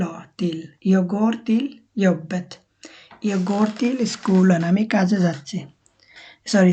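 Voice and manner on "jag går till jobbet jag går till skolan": slow Swedish teaching model; slow model phrase. Shown as a demonstration. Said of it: "এটা হবে তিল". (ধীরে, নরম দন্ত্য ত দিয়ে till)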